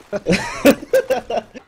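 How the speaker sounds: high real laugh; laughter. Real, not acted. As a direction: laugh at the chaos. Caster laughs at everyone dying.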